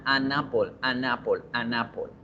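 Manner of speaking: "an apple" repetido, la ene pegada a la vocal; enlace fluido. Shown as a demonstration. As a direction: encadena "an apple" como una sola palabra. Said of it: "Todo fluido". (todo fluido y ligado)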